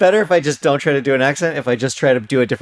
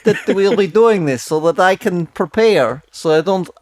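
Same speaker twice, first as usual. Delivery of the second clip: lightly put-on Russian accent, groping for idioms; a Russian guest fumbling for English words; 6 semitones higher, slower than usual. Co-host greets Daniel in a light put-on Russian accent. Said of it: "the Russian character that you're doing".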